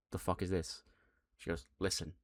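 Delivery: cold disgust. Retold or imitated. Utami disgusted, cold reply.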